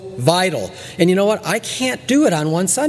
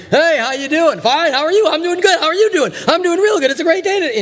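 much higher, louder, wired and overeager; coffee freak; 10 semitones higher, 3 dB louder, faster than usual. Retold or imitated. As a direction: bounce through it like a man on ten coffees. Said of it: "the guy that drank too much coffee at work".

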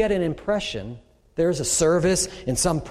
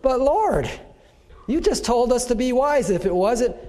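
protesting believer; 6 semitones higher than usual. Pretend. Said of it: "What, Lord?".

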